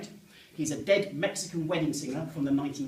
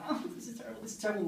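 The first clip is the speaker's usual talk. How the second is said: high, softer fretting; panicked self-talk; 8 semitones higher, 4 dB quieter, much faster than usual. Acted. Man running through the woods, panicking to himself. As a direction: fret to yourself mid-flight, pitch up. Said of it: "and I go".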